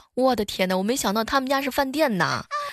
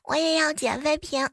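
put-on child voice; 5 semitones higher than usual.

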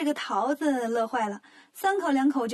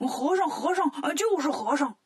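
rowdy heckling; 2 semitones higher than usual. Acted. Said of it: "八戒也跟着起哄".

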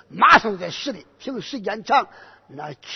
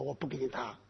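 muttered refusal; 7 semitones lower, 17 dB quieter, faster than usual. Acted. General refusing quietly.

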